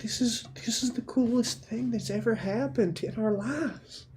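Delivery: put-on high, soft, upset child voice; small high-pitched voice of a child protesting; pleading kid Frankie. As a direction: plead softly like a hurt little kid. Plays Frankie, a fifth grader.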